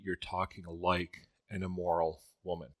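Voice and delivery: ordinary delivery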